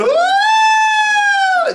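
very high loud siren woo; teacher imitating a siren for kids; siren imitation. Shown as a demonstration. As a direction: whoop like a fire truck siren. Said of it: "you're going".

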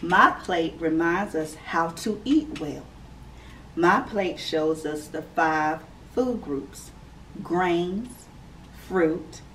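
clear, measured read-aloud voice; gentle storybook reading for kids; reading to children. Acted. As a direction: read it out like story time for little ones. Reads picture book to children.

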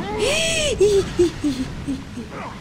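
long, high, squeaky gasp; alarmed gasp. Real, not acted. Player watching her steps, long high alarmed gasp. Nearly falls in game.

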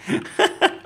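high, airy giggle of disbelief; breathless laugh. Real, not acted. Vlogger still amused about getting struck, airy giggle.

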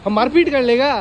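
loud, high-pitched challenge; mock confrontation. Vlogger challenging friends to a fight, mock confrontation. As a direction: demand it loudly, pitched high, play-fighting.

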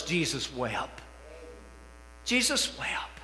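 solemn hush. Real, not acted. Solemn quoting of shortest verse.

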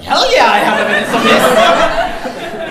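loud and laughing, stretched out slowly; high laughing outburst; laughing indignant shout. Retold or imitated. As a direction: blurt it out, half laughing at the absurdity. Indignant reply to security guard.

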